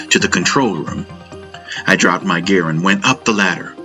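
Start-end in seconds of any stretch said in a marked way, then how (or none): none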